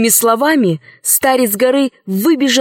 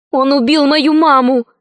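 put-on higher-pitched child's voice; insistent little girl; 5 semitones higher than usual. Girl repeats the charge against the count, insistent high voice.

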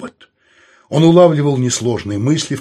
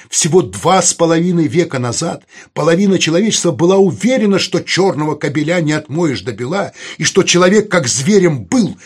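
raised, louder and higher oratory; impassioned argument; 6 semitones higher, 5 dB louder than usual. Acted. Saul lecturing the young people on human history, voice raised insistently. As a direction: drive the point home forcefully. Saul's speech builds in argument.

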